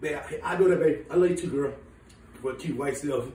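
low, soft sweet talk; tender, hushed aside, pitched low; affectionate aside. Acted. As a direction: drop down quietly to flirt with someone close. Sweet aside to someone nearby.

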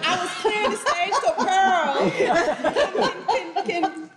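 high, loud, laughing through the words; laughing while explaining. Real, not acted. Host laughing sheepishly to the panel, explaining the stage mix-up. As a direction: giggle out the excuse, a little flustered. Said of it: "What is wrong with you?".

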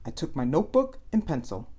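plain reading voice

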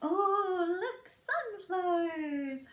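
ordinary delivery

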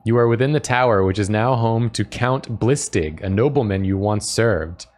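ordinary delivery

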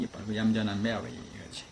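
ordinary delivery

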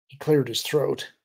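ordinary delivery

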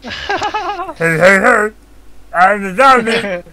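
loud, high-pitched goofy zombie. Pretend. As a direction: yell like a silly zombie. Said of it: "our best zombie voices".

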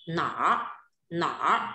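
"nỏ" ending in a curled-tongue R; final R sound. Shown as a demonstration. Teacher models the word for class, "nỏ" with R colouring. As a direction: curl the tongue up at the end of "nỏ". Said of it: "cái âm R ở cuối".

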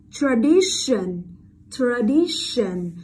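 tradition drawn out slowly with a long sh in -tion. Shown as a demonstration. Teacher shows students the sh sound, tradition said slowly with long sh. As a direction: model "tradition" slowly, holding the SH. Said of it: "tờ ion là dấu hiệu của âm sh".

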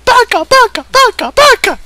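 high, loud squealing Baka while slapping; anime scream. Acted. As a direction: squeal it as you slap him. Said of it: "She screamed at him".

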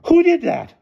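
very high, loud shriek of a question; shrill it out as an outraged headmistress. A narrator's reading. Headmistress demands the culprit after the alarm, shrill. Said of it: "shrilled Miss Scrimmage".

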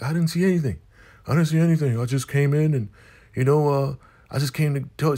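high-pitched flustered excuse, lightly acted; mock nervous denial. Lewis denying to the elder mother what he saw, mock flustered. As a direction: play a caught witness scrambling to deny it. Caught witness nervously denies it.

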